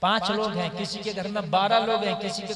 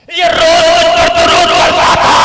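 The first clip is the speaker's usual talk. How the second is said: higher, louder, roaring sermon; shouted full-force preaching; 7 semitones higher, 18 dB louder than usual. Acted. Preacher roaring to the gathering about the grave, shouting. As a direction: shout it over the crowd.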